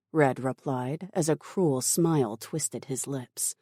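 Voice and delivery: plain reading voice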